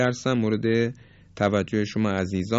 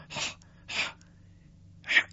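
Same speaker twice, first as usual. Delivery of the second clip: raspy, throaty ha as if clearing a seed from the throat; 10 dB quieter, faster than usual. Shown as a demonstration. Teacher showing the husky feel of the ha sound.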